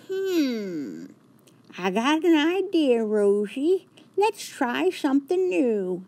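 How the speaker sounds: muse, then brighten with the idea; George's voice. A narrator's reading. George tells Rosie he has an idea.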